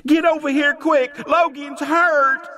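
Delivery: very high, loud, dragging out Logan's hurt; urgent panicked call. Acted. Young boy summoning the others to injured Logan, a terrified high call. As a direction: call them over in terror.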